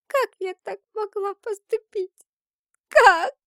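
put-on distraught girl, high and loud; anguished self-reproach. A narrator's reading. Girl shaking her head over what she has done, anguished and high. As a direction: cry out in disbelief at yourself, pitch far up. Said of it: "тряся головой, проговорила девушка".

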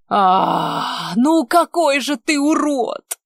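yelling in helpless rage; helpless fury yell. A narrator's reading. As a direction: wail it, furious and powerless. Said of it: "завопила она в бессильной злобе".

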